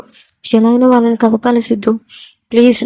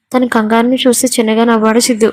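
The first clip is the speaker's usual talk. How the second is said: plain reading voice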